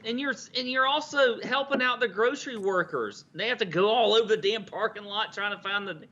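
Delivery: worked-up, high-pitched complaint; so mad. Real, not acted. Listener defending grocery workers, high worked-up rant. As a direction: vent on behalf of the workers. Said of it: "God, that makes me so mad".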